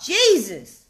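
ordinary talk, nothing marked

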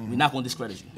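calm sincere. Real, not acted. Rapper calming his rival before the battle, low and sincere. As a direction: ease off and speak low and sincere.

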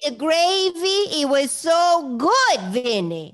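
high, slow, drawn-out Cajun character; Marie. Acted. Telling Vinny about the gravy, in Marie's voice. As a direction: gush about it in a stretched New Orleans drawl. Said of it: "if you did Marie, you did a proper Cajun accent".

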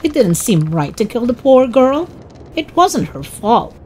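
usual speaking voice

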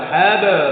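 হ্যাঁ, বা with a bounced echo on the stop; echoing qalqala. Shown as a demonstration. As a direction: push বা out with a jolt. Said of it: "প্রতিধ্বনি আওয়াজ দিয়ে পড়তে হবে". (ধাক্কা দিয়ে প্রতিধ্বনি উচ্চারণ)

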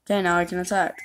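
normal talking voice

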